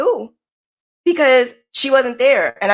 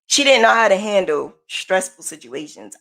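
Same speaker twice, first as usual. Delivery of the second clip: normal talking voice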